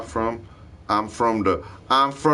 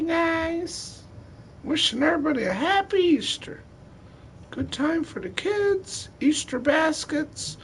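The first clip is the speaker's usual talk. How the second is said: very high, squeaky bunny falsetto; Peter Cottontail. Pretend. Wishing everybody a happy Easter, squeaky bunny falsetto. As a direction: keep up a tiny cartoon rabbit voice. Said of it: "Brought Peter Cottontail out".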